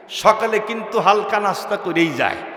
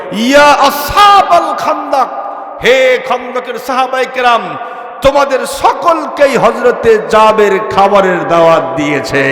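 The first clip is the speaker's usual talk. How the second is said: ringing call to a whole crowd; loud proclamation; 2 semitones higher, 5 dB louder than usual. Retold or imitated. Prophet announces Jaber's invitation to all companions, loud proclamation. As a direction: proclaim it loudly, drawing out the address. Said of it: "জোর কণ্ঠে".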